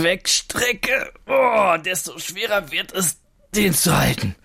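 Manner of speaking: effortful, strained voice rising in pitch; straining under weight. Shown as a demonstration. Reporter demonstrates holding the rucksack away from himself, straining. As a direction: groan and push the words out while holding a heavy load. Holds heavy rucksack at arm's length.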